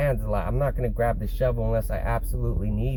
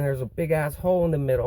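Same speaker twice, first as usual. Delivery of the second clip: ordinary delivery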